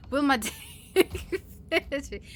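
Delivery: helpless giggle cutting into the line; laughing mid-phrase. Real, not acted. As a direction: break up giggling between the words. Cracking up over rude names.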